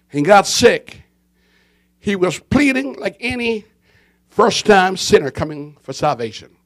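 ordinary delivery

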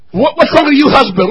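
ordinary talk, nothing marked

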